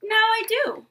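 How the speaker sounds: high, loud proud finish; loud, high proud ending; triumphant. Acted. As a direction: land the ending with a big proud lift.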